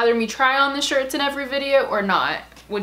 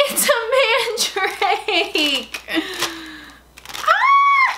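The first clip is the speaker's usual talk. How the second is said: very high, slow drawn-out squeal of joy; ecstatic squeal; 9 semitones higher, much slower than usual. Real, not acted. Finding the mandrake she wanted, ecstatic squeal. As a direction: shriek it out, overjoyed.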